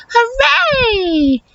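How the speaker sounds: normal talking voice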